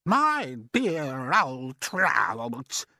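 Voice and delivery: high, slow, preening aunt's voice; drawn-out mocking call to her sister; vain aunt calling out. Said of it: "Aunt Sponge cried out".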